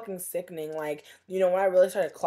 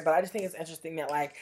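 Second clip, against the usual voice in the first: normal talking voice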